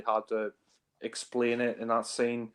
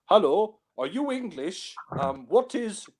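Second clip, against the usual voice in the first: higher-pitched imitation of Connery's foreign accent; Connery's put-on accent; 7 semitones higher than usual. Reviewer mimicking Connery's disguise in the film, put-on accent. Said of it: "when Connery puts on this, like, accent".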